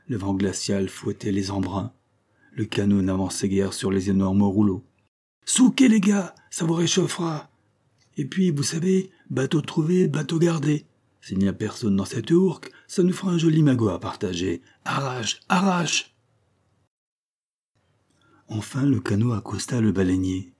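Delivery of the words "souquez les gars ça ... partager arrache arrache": high-pitched urging to the rowers; hearty skipper egging his crew on; rousing sea captain. Acted. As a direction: drive the oarsmen on, calling out.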